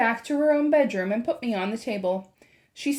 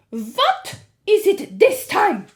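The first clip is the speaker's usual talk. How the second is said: slow, loud, high witch shout; 10 semitones higher, 8 dB louder, much slower than usual. A narrator's reading. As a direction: drag out an irritated yell. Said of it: "shouted the Grand High Witch".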